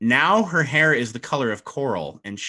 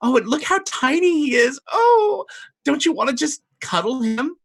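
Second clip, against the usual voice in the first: put-on much higher baby-talk coo; cooing mermaid; 4 dB louder than usual. Vanamira coos over the tiny redcap, very high. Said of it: "She says".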